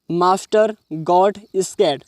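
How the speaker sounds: very slow, deliberate English; slow reading aloud. Shown as a demonstration. Teacher reading the story line slowly to students. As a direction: stretch each word out for the class.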